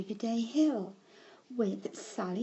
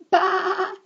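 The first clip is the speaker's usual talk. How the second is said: loud, high sheep's baa; bleating sheep; 10 semitones higher, 10 dB louder than usual. A narrator's reading. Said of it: "went the sheep".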